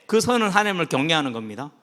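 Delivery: ordinary talk, nothing marked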